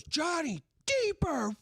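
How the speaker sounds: high, slow outraged yell of the name; dad shouting. Retold or imitated. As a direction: bellow the name as the shocked father. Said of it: "he just goes".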